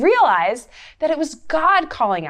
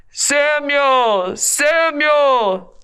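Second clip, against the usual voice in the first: God voice; 3 semitones higher, much slower than usual. Shown as a demonstration. Teacher leads kids in a hammed-up God voice. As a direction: call out slowly like God himself. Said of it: "I need your best God voice".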